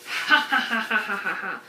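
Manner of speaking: hammed-up villain cackle; evil scientist laugh. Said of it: "we're like an evil scientist experimenting".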